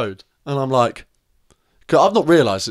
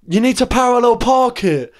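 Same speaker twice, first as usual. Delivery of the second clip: raised voice instruction; 11 semitones higher, 7 dB louder than usual. Retold or imitated. Telling driver loudly how to park.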